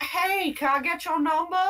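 high-pitched goblin come-on; flirting goblin. Acted. Chuff is interested in the goblin woman, flirting. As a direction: chat her up in a squeaky character voice. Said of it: "he's, like, pretty interested in this woman".

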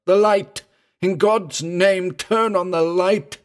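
high, loud plea in terror; terrified cry. A narrator's reading. Singh begging for light at the seance, terrified cry. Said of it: "cried out in a broken voice of terror".

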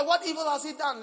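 high, loud pleading cry; shouted plea. Acted. Preacher shouting Pilate's question at the crowd, high and loud. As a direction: demand an answer loudly.

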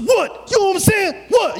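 high, loud mock outburst; exasperated shout. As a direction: yell it like you finally snapped. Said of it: "we be like".

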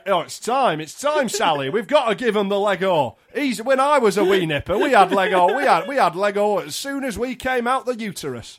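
hammed-up northern dad insisting proudly; imitated northern dad. Dad persuading Sally their son is ready for Lego, imitated northern dad. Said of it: "if this is my dad".

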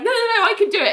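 high-pitched, panicky mock protest; pleading protest. [name] protests being taken off case.

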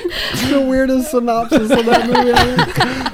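high, slow words through laughter; laughing hard. Real, not acted. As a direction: barely get it out for laughing. Cracking up at absurd synopsis.

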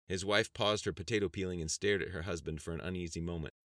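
ordinary delivery

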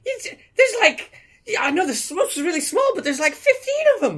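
high, loud excited babble; thrilled high-pitched explaining; giddy excitement. Acted. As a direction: gush like an overexcited child. Excitedly explains minibar haul.

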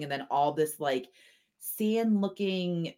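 usual speaking voice

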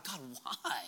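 a soft plea to God; quiet plea. Retold or imitated. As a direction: barely breathe the question. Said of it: "we've all asked God".

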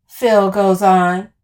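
usual speaking voice

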